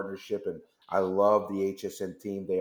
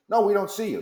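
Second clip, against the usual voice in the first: exasperated control room; 10 semitones higher, 6 dB louder than usual. Retold or imitated. Control room insists, back and forth.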